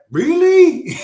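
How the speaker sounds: loud, very high squeal; hammed-up astonishment, shooting way up; mock amazed shriek. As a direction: squeal it in huge disbelief. Retold amazed reaction to revelation.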